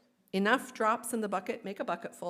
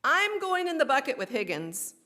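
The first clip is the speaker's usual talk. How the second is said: eager call, a bit louder; shouted; 5 semitones higher, 5 dB louder than usual. A narrator's reading. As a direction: call out to jump in with Higgins. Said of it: "one drop shouted".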